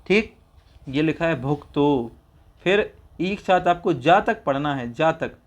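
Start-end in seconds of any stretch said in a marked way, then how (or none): none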